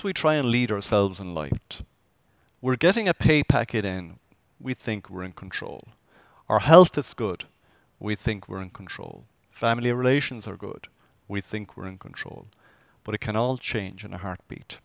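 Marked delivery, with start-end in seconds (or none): 4.6-5.9 quiet refrain. Acted. Repeated refrain, dropped. 8.0-9.3 quiet refrain. Acted. Repeated refrain, dropped. 11.3-12.5 quiet refrain. Acted. Repeated refrain, dropped.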